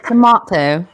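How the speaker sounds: loud put-on British "to-MAH-to"; Mary Poppins British accent. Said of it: "Do your Mary Poppins British accent".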